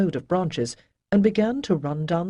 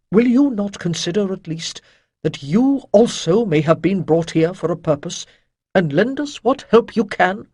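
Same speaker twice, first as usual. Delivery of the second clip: louder, solemn persuasion with strain; strained mage; 6 dB louder than usual. Acted. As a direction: plead for help under effort. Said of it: "his voice was edged with strain".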